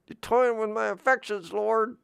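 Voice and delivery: a hurt, high-voiced son protesting; high voice of Isaac.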